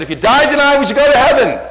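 high, full-voiced challenge; loud evangelizing question. Preacher retelling his question to a young man, loud and high.

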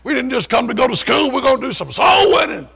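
preacher stirring up his Bible study; fiery preaching. Retold or imitated. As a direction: rouse the room like a young preacher. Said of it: "And he said".

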